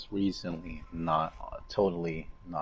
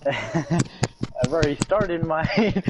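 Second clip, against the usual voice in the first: laughing; 8 semitones higher, 6 dB louder, much slower than usual. Real, not acted. Laughing while starting his podcast.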